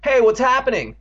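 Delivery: high, loud hail; calling out. A narrator's reading. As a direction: call across the street. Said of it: "a voice called".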